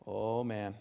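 ordinary talk, nothing marked

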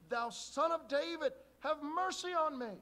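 loud high slow plea; crying out. Acted. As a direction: beg loudly from the roadside. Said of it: "But he cried the great deal".

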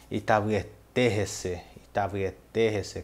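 "Itavuieterrece" said slowly and clearly; careful, unhurried "Itavuieterrece"; slow Guarani word. Shown as a demonstration. Teacher says Itavuieterrece slowly for students. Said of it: "aí a gente fala assim".

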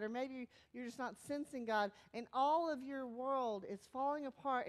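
usual speaking voice

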